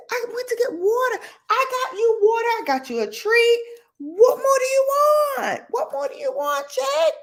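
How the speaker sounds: frazzled owner pleading with pet; exasperated to dog. Retold or imitated. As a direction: scold the spoiled dog. Talking back to whining dog.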